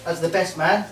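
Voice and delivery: ordinary delivery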